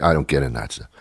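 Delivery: ordinary delivery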